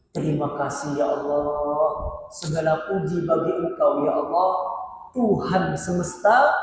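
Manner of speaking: doa yang lirih. Acted. Mencontohkan doa dalam hati.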